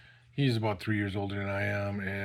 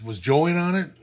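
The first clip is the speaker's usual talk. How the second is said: higher, louder imitated questioning; retold interrogation; 6 semitones higher, 8 dB louder than usual. Cop asking whether friend was involved, pressing question.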